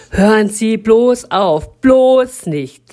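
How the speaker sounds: high and loud, slow outrage; the man at the bus stop recoiling; indignant refusal. Acted. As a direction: recoil in horror.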